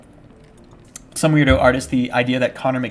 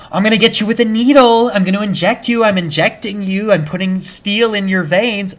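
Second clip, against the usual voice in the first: put-on girlfriend's threat; 7 semitones higher, 5 dB louder than usual. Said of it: "She goes".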